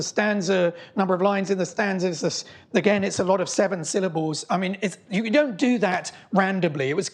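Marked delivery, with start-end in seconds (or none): none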